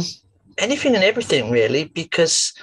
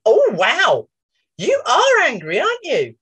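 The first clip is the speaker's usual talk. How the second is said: high, loud mock-amazed voice; addressing the anger as if it were a pet, mock wonder; talking to the pretend anger; 6 semitones higher, 4 dB louder than usual. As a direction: act astonished at the creature in your hand. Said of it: "pretended to take something from his hand".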